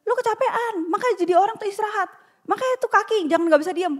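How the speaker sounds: mock nagging relatives. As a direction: rattle it off like a preachy relative. Relatives judging her, nagging.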